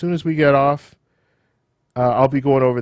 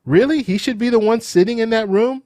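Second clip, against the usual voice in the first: incredulous; 10 semitones higher than usual. Real, not acted. Incredulous about chief of staff.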